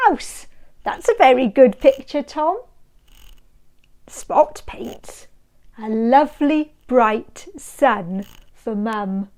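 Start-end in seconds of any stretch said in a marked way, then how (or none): none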